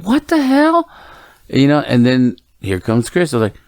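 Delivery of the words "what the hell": high-pitched retold outburst; incredulous, pitched way up; exasperated disbelief. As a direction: act out a dad's baffled protest. Retold parent's disbelief at daughter's refusal.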